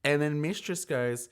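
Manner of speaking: usual speaking voice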